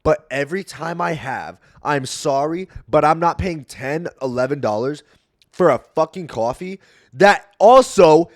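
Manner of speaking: ranting at a friend, rising to a shout; building angry rant. Retold or imitated. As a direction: build from firm to yelling about overpriced coffee. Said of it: "That might have been really loud in your ears".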